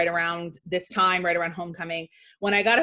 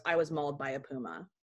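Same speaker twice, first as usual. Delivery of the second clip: dead serious and much softer; 5 semitones lower, 9 dB quieter than usual. Retold or imitated. Brother tells a classmate in dead seriousness. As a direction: sell the lie with a straight face. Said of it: "And in dead seriousness".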